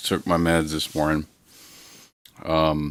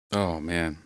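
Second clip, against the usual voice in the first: usual speaking voice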